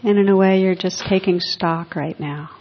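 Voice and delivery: guided reflection. Acted. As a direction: ease listeners inward, letting lines fade. Leads a quiet reflection.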